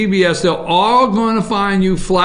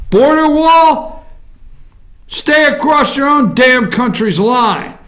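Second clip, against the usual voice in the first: emphatic rant; 6 semitones higher than usual. Acted. Ranting about the border wall.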